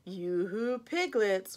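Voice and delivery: ordinary delivery, nothing marked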